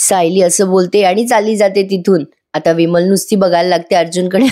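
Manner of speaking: ordinary delivery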